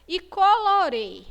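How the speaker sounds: high, drawn-out colorê ending closed; closed vowel. Shown as a demonstration. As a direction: stretch colorê with a narrow Ê. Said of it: "o som é fechado".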